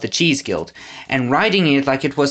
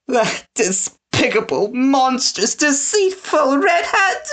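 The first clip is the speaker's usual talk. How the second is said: rising much higher, slow, crying in anger; tearful fury; 11 semitones higher, slower than usual. A narrator's reading. Winnie curses Snatcher, angry and crying. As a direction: spit each insult through tears. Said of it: "wiping away tears".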